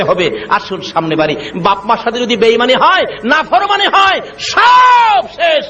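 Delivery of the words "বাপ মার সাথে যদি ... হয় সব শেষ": rising preacher's warning. Real, not acted. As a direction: build from firm preaching to a high cry. Preacher builds a warning to climax. (ক্রমে চড়া গলায় হুঁশিয়ারি)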